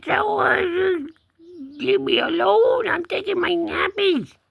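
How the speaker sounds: very high put-on kitty voice; cat voice. Cat wanting his nap, very high cat voice. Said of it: "He says".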